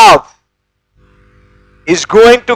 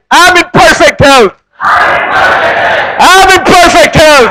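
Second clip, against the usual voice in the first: shouted affirmation; 6 semitones higher, slower than usual. Shown as a demonstration. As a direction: belt it out to the whole hall. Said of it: "Tell the person on your left and right".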